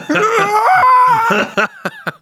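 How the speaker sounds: high burst of laughter; screaming laugh at the joke; loud shrieking laugh. Real, not acted. Primal scream joke, big laugh.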